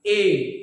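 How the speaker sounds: drawing out the vowel ए for the class; vowel ए demo. Shown as a demonstration. Teacher showing pupils the half-close vowel, a drawn-out ए. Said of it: "इसमें आप बोलिएगा ए".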